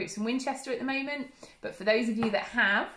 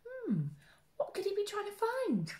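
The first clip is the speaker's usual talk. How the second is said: hushed wondering; 9 semitones higher, 8 dB quieter than usual. Acted. Wondering aloud to children.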